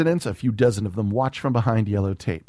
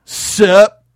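high loud dude voice; casual teen greeting; 9 semitones higher, 11 dB louder than usual. Acted. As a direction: toss it off like a slacker.

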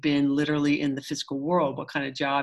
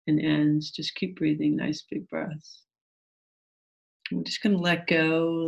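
calm, gentle meditation guide. Acted.

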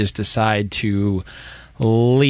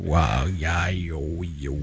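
into a fan; 6 semitones lower, 6 dB quieter, much slower than usual. Retold or imitated. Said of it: "he talks into the fan".

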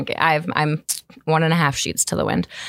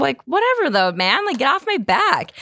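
dismissive; 7 semitones higher than usual. Retold or imitated. Brushing off the man.

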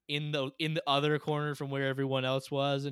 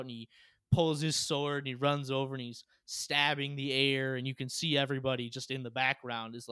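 ordinary talk

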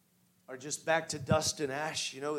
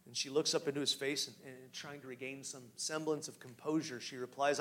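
usual speaking voice

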